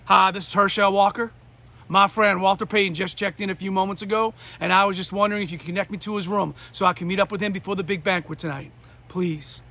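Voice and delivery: ordinary delivery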